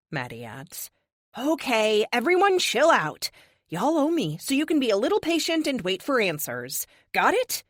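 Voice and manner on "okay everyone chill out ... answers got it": higher, louder, commanding the room; bossy leader settling the team; firm call to order. A narrator's reading. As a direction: take charge of the room, bring everyone to heel. Narrator commands the squabbling team.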